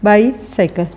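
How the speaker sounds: Bicycle with a gap after बाई; syllable break. Shown as a demonstration. Teacher pointing out the syllable, bicycle split in two. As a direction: say bicycle as two separate sounds, बाई and cycle. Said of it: "इसमें syllable आ गया है".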